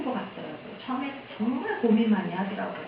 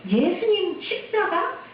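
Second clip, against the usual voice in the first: mock complaint; 6 semitones higher than usual. Church members telling the pastor what not to preach, protesting. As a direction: imitate objectors, pitch raised and words stretched.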